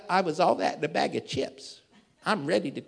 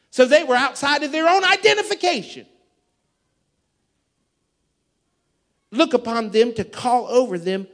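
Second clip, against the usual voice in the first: loud, raised sermon voice; full-force preaching; 8 semitones higher, 10 dB louder than usual. Real, not acted. Pastor preaching scripture to the congregation, full force.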